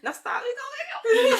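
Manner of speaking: real laughter breaking through tears; teary laughing. Real, not acted. Laughing through tears about her mom, real and emotional. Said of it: "I'm emotional right now".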